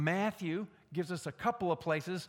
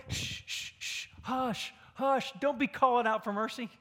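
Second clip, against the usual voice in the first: hissing hush then higher scolding; shushing crowd; 5 semitones higher, 3 dB louder than usual. Retold or imitated. The crowd shushes the blind beggar. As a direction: shush him down like an annoyed crowd. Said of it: "They were trying to say".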